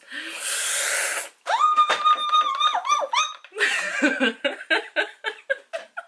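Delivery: long shrieking laugh climbing high; hysterical laugh. Real, not acted. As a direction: burst into a giddy high-pitched laugh. Overexcited about new palette.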